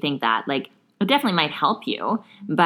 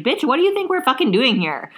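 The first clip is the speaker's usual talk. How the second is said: sassy retort; 8 semitones higher than usual. Retold or imitated.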